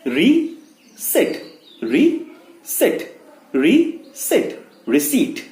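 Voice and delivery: "receipt" as ri-SEET, high and rising; silent P drill. Shown as a demonstration. Teacher drilling the word, silent P. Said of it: "So what is it?".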